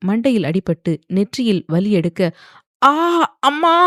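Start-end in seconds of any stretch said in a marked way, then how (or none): 2.8-3.8 high, loud cry of pain. A narrator's reading. Choked young woman hits her head, pained cry. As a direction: cry out hurt for mother. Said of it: "இருமியபடி மெல்லிய குரலில் அலறினாள்".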